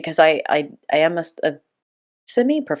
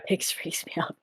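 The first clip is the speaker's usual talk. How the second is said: whispered, much softer; whispered aside; 14 dB quieter than usual. Real, not acted. Host whispering an aside about the co-host, in a whisper. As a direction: breathe it as a secret aside.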